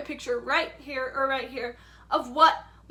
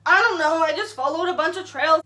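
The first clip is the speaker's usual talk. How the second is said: louder, exasperated reply; loud frustrated answer; 3 semitones higher, 9 dB louder than usual. Retold or imitated. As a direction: blurt it out at full volume. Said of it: "And I'm like".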